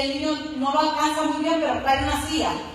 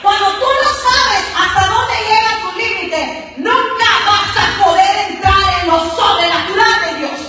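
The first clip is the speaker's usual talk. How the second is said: loud, slow, emphatic exhortation; preaching at full force; 5 semitones higher, 5 dB louder, slower than usual. Acted. Preacher exhorting the church to know its limits, loud and slow. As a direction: press each phrase on the listeners.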